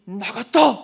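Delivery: loud cry of release; the grandmother shouts in triumph; shouted exclamation. Retold or imitated. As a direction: bellow it like you're set free. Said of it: "그러는 거예요".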